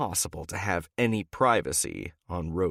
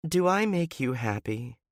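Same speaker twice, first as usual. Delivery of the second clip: higher woman's voice with a hint of sadness; a sad note; 7 semitones higher than usual. A narrator's reading. Wife asking her man if she makes him happy, faintly sad. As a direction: ask it with a touch of sadness. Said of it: "a sad note in her voice".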